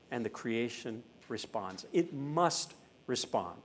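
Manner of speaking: slow, hushed, weighty pronouncement; solemn. Real, not acted. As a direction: let each phrase sink in, softly and slowly. Preacher solemnly states his big truth.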